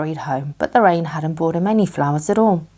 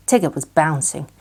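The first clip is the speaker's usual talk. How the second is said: plain reading voice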